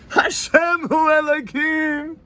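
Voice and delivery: high, slow shouted prayer. Retold or imitated. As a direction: cry it out in ecstasy. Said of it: "I was like".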